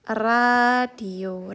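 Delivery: radio with a tapped Korean r. Shown as a demonstration. Teacher modelling Korean pronunciation: radio, crisp tapped r.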